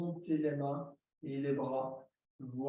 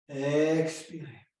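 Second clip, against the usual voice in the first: Expirez stretched slow and louder; drawn-out cue; 6 dB louder, slower than usual. Shown as a demonstration. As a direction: push the exhale out with a long Expirez. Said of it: "cueing a long exhale". (Expirez appuyé et allongé)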